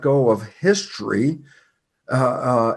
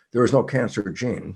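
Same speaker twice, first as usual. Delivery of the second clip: ordinary talk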